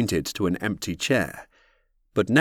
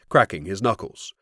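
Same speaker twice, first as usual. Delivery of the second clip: usual speaking voice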